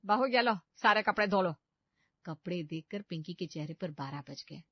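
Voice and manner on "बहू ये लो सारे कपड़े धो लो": grumbling mother-in-law. Acted. Sulky mother-in-law hands over laundry.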